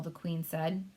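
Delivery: ordinary delivery, nothing marked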